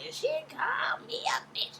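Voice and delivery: high, slow whispered whine; mock whine as Mama Joyce; whiny Mama Joyce. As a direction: whimper it like a wronged old lady. Mama Joyce quoted whining.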